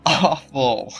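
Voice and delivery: ordinary delivery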